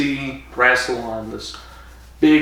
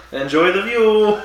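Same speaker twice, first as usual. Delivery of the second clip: gleeful taunt; 9 semitones higher, 4 dB louder than usual. Retold or imitated. Mocking the trapped Barusa.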